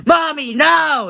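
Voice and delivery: put-on high, drawn-out child whine; whiny child. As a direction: whine like a kid denied a snack. Child whining at mom.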